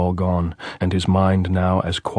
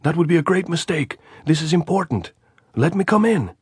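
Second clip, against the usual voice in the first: shouting; 11 semitones higher than usual. A narrator's reading. Andres answering the sentry's challenge, shouting. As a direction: yell it up so they hear. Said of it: "Andres shouted".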